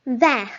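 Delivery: Vert with a silent t, loud; Vert, throaty r, no final t; loud French vert. Shown as a demonstration. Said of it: "Repeat after me".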